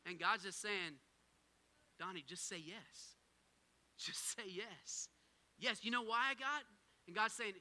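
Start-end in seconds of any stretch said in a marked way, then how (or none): 2.0-5.1 slow, soft and hushed, gently urging; a quiet fatherly nudge; soft voice of God. Acted. God speaking softly to the pastor, hushed.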